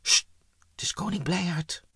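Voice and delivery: whispered, hushing the other. Acted. Imp calming a frightened friend, whispered. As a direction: hush them and whisper the news.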